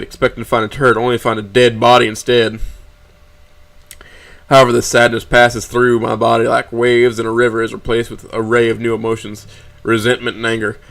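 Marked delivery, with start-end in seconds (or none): none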